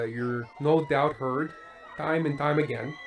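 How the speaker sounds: usual speaking voice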